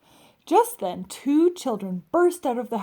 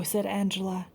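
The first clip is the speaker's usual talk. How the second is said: ordinary delivery, nothing marked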